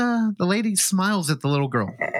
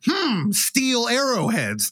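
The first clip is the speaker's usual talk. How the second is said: knowing musing; 7 semitones higher, much slower than usual. Retold or imitated. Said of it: "who immediately was like".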